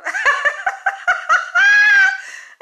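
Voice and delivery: long, loud, very high laugh; big cackling laugh. Real, not acted. Woman reacting to a viewer's comment, cackling helplessly. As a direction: burst into shrieking laughter.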